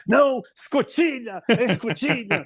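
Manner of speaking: sharp, high, slow correction; irritated correction. Retold or imitated. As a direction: correct the word like an annoyed father. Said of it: "E meu pai".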